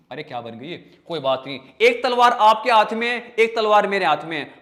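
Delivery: normal talking voice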